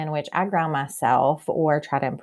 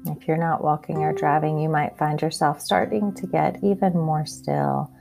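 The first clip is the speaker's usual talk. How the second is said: calm grounding exercise. Acted. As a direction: guide them gently into their senses. Leads a grounding meditation.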